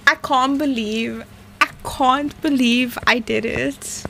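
ordinary talk, nothing marked

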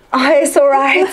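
mock English. Shown as a demonstration. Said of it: "İngilizcen de var".

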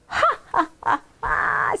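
high "ha ha" fading breathily; a put-on scoffing laugh; mock laughter. A narrator's reading. Henrietta scoffing at her sisters, mock laughing.

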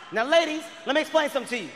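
normal talking voice

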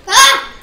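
sudden frightened scream; jump-scared shriek; scared yelp. Real, not acted. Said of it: "really jump scared me".

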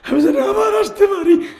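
high, loud wailing plea; tearful pleading. Retold or imitated. Departing pilgrim begging the Prophet, tearful pleading. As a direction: cry it out, begging to return.

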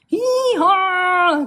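high, loud braying hee-haw; loud donkey cry. Acted. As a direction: bray it like a startled donkey. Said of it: "cried the donkey".

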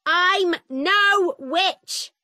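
high, drawn-out shouted protest; slow, high-pitched outraged yell; angry shout. A narrator's reading. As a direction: cry out your innocence, every word stretched. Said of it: "my mother shouted".

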